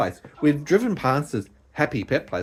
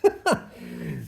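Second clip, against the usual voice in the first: high burst of laughter; 14 semitones higher than usual. Real, not acted. Laughing at the other's remark.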